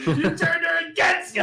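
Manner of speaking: high, loud hammed-up accusation; mock anguished shout. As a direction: cry out like a betrayed movie hero. Parodies Star Wars confrontation, laughing.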